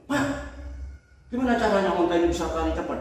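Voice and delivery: impatient eager question. Retold or imitated. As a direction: rush it like a greedy rider.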